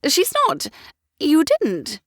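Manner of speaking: high, excitable lady's voice; fussy society mother. A narrator's reading. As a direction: chatter like a flighty mother of the ton.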